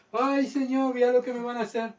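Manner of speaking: high-pitched mock wail; lamento burlesco. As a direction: parody a fearful martyr pleading to God. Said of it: "Murieron cantando y alabando a Dios". (lamento fingido con voz aguda)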